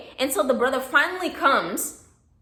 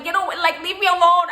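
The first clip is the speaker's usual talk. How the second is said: higher and louder, brushing him off; mock shoo-away; 6 semitones higher, 4 dB louder than usual. Narrator to the staring neighbor, a loud mock brush-off. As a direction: swat the nosy neighbor away with your voice.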